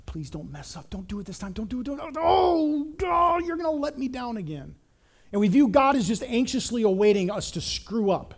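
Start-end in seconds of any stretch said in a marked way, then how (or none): 0.0-1.8 soft, nervous pleading; anxious God. Retold or imitated. Preacher imitates an anxious God on his couch, soft fretting plea. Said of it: "biting his nails at us". 1.8-4.8 a higher mock cry of dismay; anxious outburst. Preacher imitates a nail-biting God, higher mock dismay. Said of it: "biting his nails at us".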